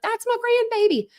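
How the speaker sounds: excited mom. Retold or imitated. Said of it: "she's like".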